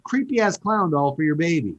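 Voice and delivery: usual speaking voice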